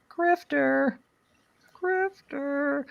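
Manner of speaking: high mocking sing-song. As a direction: sneer it high and singsong. Mocking women with patches.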